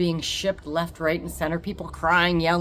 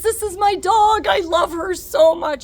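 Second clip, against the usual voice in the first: much higher and louder, gushing slowly; gushing dog lover; 13 semitones higher, 8 dB louder than usual. Retold or imitated. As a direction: beam with pride over his dog. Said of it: "his entire demeanor changes".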